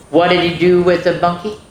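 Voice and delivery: ordinary delivery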